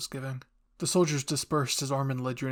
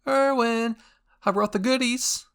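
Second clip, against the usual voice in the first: much higher, louder, eager call; excited Hanji; 10 semitones higher, 8 dB louder, slower than usual. Acted. Hanji calls to Erwin about her supplies, high and eager. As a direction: bounce in like a giddy scientist.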